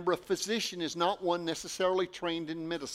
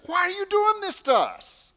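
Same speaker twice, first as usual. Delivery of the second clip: much higher and louder, DOING pushed hard; anguished mother; 10 dB louder than usual. Acted. Mary confronting her son at the temple, anguished and loud. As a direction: cry it out as a frantic mother. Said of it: "She was full of emotion and, and passion".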